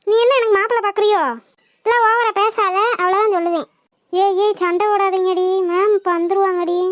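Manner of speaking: ordinary delivery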